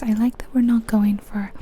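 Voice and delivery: ordinary delivery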